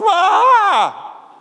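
high, loud mock yelp of fright; startled yelp. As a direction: jump out of your skin with a short cry. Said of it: "Now Moses is like".

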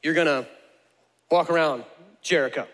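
patient dictation with pauses between phrases; slow, spaced-out instructions. Acted. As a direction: break it down phrase by phrase for someone taking notes. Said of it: "let me slow it down for you".

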